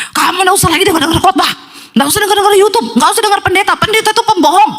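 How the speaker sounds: high-pitched acted tirade; possessed husband's rant. Red-eyed possessed husband forbidding his wife sermons, high angry rant. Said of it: "suaminya matanya merah, ngomong ke istrinya".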